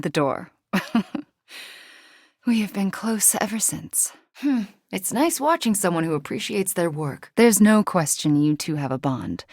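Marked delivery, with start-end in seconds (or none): none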